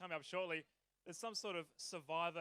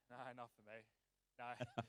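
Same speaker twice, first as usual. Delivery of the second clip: a quiet, deep, turned-away no; low mumbled refusal; 6 semitones lower, 7 dB quieter than usual. Real, not acted. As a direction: drop to a low, much softer mutter. Declining the beer offer, dropped voice.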